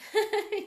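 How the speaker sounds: high, loud embarrassed laugh; a quick real laugh; short laugh. Real, not acted. Laugh after hair mishap.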